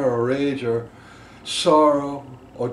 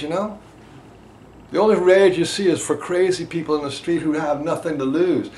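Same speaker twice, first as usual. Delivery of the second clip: usual speaking voice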